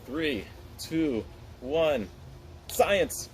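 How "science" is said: excited shout. Real, not acted. Countdown then launching the Mentos.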